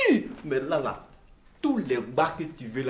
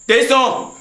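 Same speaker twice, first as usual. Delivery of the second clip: higher, louder insistent call; 9 semitones higher, 10 dB louder than usual. Acted. Master calls the servant Tesson again, louder and impatient.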